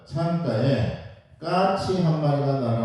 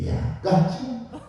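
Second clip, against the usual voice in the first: cheerful father; 10 semitones higher than usual. Retold or imitated. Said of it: "아빠, 저게 뭐야?".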